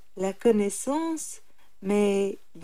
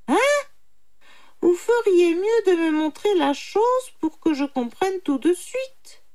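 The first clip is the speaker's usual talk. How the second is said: high put-on animal voice; confused little jackal; 9 semitones higher, 4 dB louder than usual. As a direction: play dim and whiny, pitched high. Jackal feigning stupidity. (petit chacal embrouillé, voix aiguë)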